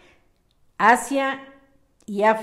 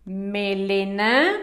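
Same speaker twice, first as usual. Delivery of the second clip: slow, stretched word, rising at the end; slow dictation; 3 semitones lower, much slower than usual. Shown as a demonstration. Teacher gives the next word after a space, slow dictation. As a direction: draw it out for children copying.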